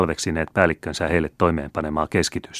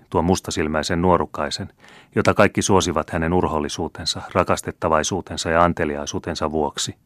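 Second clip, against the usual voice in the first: ordinary delivery, nothing marked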